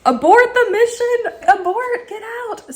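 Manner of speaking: high, drawn-out alarm; mock emergency. Chilly showerer abandoning rinse for phone, mock-panicked. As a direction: call it out like a high-pitched mission alert.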